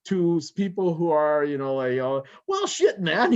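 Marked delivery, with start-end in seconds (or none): 2.5-3.3 high, loud mock slang outburst; a sudden crude street-talk exclamation, half joking.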